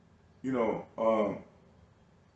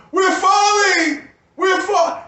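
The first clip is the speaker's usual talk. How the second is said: much higher, louder, slow drawn-out yell of "We're falling!"; hollering alarm; 6 dB louder, much slower than usual. Retold or imitated. Yelling to everyone as we fall, a drawn-out alarmed holler. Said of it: "I holler".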